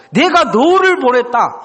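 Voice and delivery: high, emphatic statement; God's declaration. Retold or imitated. God telling Gideon he was sent, high and commanding. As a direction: declare it with full authority. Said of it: "이렇게 말씀하신 거죠".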